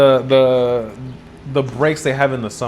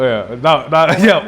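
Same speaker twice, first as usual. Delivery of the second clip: ordinary talk, nothing marked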